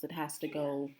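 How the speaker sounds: normal talking voice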